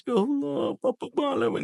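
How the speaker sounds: high, wailing mock cry; tearful father, acted. Father carrying his baby, acted tearful wail. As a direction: act out a dad crying as he carries his baby. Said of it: "sambil nangis".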